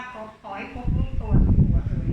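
loud wailing plea to the spirits; pleading prayer. Acted. Mother begs spirits to find her son, loud wailing plea. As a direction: cry out a desperate mother's vow. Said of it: "จะบนหมูสุราร่ำว่า".

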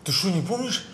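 normal talking voice

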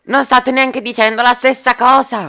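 very high, heated protest; exasperated yell. Acted. Shouting quarrel over milk.

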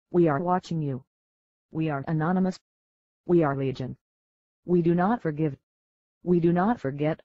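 ordinary talk

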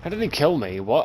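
loud, disbelieving outburst; raging gamer. Real, not acted. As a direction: yell it in furious disbelief. Dying unfairly in a shooter.

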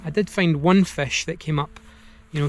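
usual speaking voice

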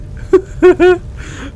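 a squeaky nervous giggle; high nervous laugh. Real, not acted. Gamer under pressure, high nervous laugh. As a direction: laugh out the tension.

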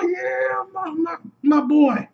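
high, strained fan squeal; elated cheering. Real, not acted. Fan cheering Cap after the magic words, high elated cry. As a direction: cheer him on, pitch way up.

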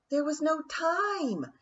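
shrieked protest. A narrator's reading. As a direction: insist desperately. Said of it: "third thought shrieked".

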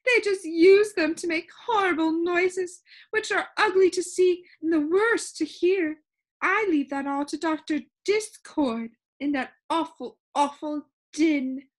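loud, high-pitched tirade; worked-up Soundkeeper voice; indignant. A narrator's reading. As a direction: complain with high, emphatic disgust. Soundkeeper indignantly refuses, rants about noise.

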